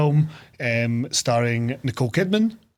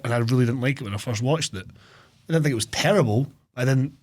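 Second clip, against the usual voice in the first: ordinary talk, nothing marked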